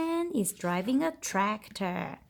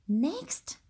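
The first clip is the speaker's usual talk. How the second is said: ordinary talk, nothing marked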